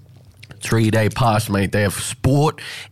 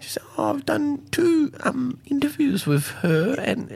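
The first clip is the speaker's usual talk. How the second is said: high mock woman voice. Quoting the singer's reply.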